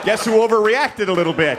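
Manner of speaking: high, loud mocking comeback; gloating retort. Man mocks partner after splattering her, loud gloating. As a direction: throw the accusation back loudly.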